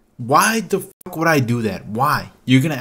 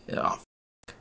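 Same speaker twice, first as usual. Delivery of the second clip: voiceless, much softer dread; whispered oh shit; 8 semitones lower, 9 dB quieter than usual. Retold or imitated. Cashier whispering as the angry customer blows up. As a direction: breathe it out in quiet panic. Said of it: "I'm like".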